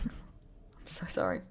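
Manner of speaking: giggling whisper; whispered laugh. Real, not acted. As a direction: breathe the apology through a laugh. Laughing apology whispered aside.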